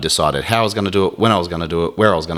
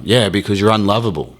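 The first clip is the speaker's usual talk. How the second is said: taunting himself, louder and higher; mocking inner critic; 5 semitones higher, 5 dB louder than usual. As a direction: jeer at yourself like a bully.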